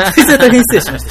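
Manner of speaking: 吹き出しつつ高く大きな声で謝る; 笑い崩れながら詫びる; 笑いながら. Real, not acted. 気まずい質問を笑って謝る. (爆笑まじりの謝罪)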